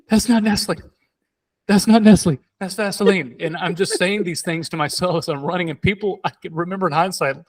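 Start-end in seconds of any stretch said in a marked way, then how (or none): 0.1-3.4 high-pitched horrified exclamation, played for comedy; mock distress. Runner who swallowed Vaseline, talking to himself, mock distress. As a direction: cry out in comic dismay.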